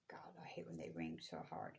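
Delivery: sigh, then a hushed whisper to yourself; real whispered aside. Real, not acted. Reader complaining to herself mid-reading, whispered. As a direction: breathe it out under your breath.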